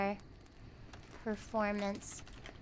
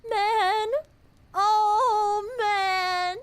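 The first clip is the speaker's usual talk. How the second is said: high, louder crying man, oh man; anguished wail; 10 semitones higher, 8 dB louder, much slower than usual. Real, not acted. Streamer lamenting a viewer's gentle rejection, wailing. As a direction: wail at the sad ending. Heartbroken by the story's ending.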